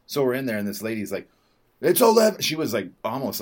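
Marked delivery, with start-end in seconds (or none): 1.8-2.4 sharp, loud, much higher bark of the time; imitating a gym attendant's loud, high call; aggro announcement. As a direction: snap it out like an annoyed attendant. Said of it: "like, uh, aggro about it".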